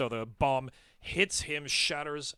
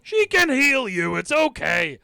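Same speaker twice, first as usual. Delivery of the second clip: reassuring shout; 9 semitones higher, 10 dB louder than usual. Acted. Reassuring ally in combat.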